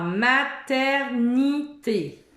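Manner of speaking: maternité spelled out very slowly, falling at the end. Shown as a demonstration. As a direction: say the whole word clearly. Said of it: "j'ai enlevé le ni".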